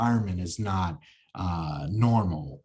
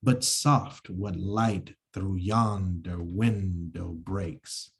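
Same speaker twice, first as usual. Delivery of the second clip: slow, stressing every second syllable; slow metrical scansion; iambic reading; slower than usual. Shown as a demonstration. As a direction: read it in da-dum rhythm. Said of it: "So that's an example of it".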